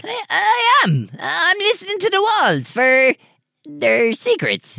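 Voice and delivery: high, slow flustered voice; nervous squeak. Acted. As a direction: stammer it out nervously. Jack caught, nervous.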